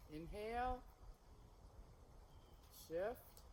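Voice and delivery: soft, slow movement cues; serene tai chi cues. Shown as a demonstration. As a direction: call each move gently, unhurried. Leads the flowing form.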